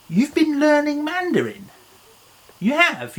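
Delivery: "You've been learning Mandarin" squeaked up high in wonder; mock surprise. Shown as a demonstration.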